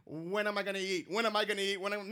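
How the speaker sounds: low, quick muttering to oneself; hungry, hurried low chant; impatient counting down. Retold or imitated. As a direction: mutter it on repeat, impatient for food. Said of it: "you're, like, counting down".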